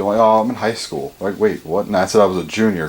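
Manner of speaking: ordinary talk, nothing marked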